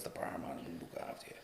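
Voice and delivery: whispering dad's promise of the pub; whispered. Retold or imitated. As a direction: drop to a whisper. Quoting dad softly.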